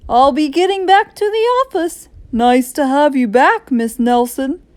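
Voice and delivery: a hint of a hearty principal; school principal. A narrator's reading. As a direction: take your leave as a principal. Principal takes his leave.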